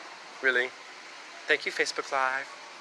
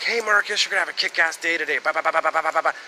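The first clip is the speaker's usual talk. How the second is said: a morning pep talk from the clock, loud and rousing; upbeat clock message; 2 semitones higher, 4 dB louder than usual. Retold or imitated. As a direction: cheer Marcus awake like a hyped radio voice. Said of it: "positive message first in the morning".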